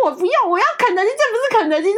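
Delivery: put-on high, fast bratty kid demanding KFC; whiny child tantrum. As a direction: squeal it like a spoiled toddler. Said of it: "我就说".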